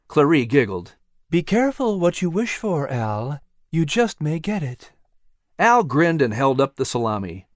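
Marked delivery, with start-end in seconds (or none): 1.3-4.9 lightly voiced lamb, a touch higher; teasing warning to the cat; playful warning. Clary voiced.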